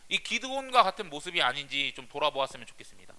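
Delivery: usual speaking voice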